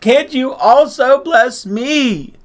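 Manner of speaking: high, loud, anguished plea; Esau crying bitterly. A narrator's reading. Cheated son begging his father for a blessing, bitter cry. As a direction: cry out, dragging the plea upward. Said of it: "sobbed violently and most bitterly and cried".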